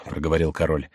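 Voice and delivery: usual speaking voice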